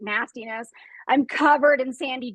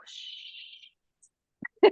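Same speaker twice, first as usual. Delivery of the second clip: shushing; 3 dB quieter than usual. Retold or imitated. Hushing dog, unvoiced.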